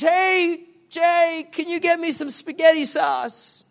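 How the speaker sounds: wife calling. Retold or imitated. Wife calling husband downstairs for spaghetti sauce, loud call. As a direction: call down to him from the kitchen. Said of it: "she says".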